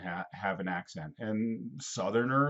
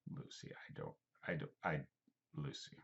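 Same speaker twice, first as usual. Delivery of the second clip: whispered aside; 9 semitones lower, 17 dB quieter than usual. Real, not acted. Aside to child Lucy.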